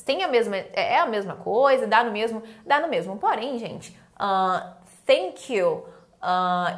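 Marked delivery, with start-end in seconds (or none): none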